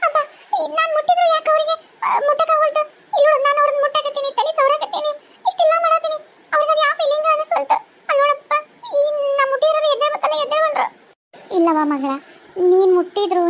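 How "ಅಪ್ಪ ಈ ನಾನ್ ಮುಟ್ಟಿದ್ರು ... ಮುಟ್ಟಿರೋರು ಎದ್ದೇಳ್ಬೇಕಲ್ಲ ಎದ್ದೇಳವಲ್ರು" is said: high, half-laughing half-crying complaint; bewildered sob-laugh; high distraught voice, laughing through tears; tearful frustration. Acted. Ghost frustrated she can't touch.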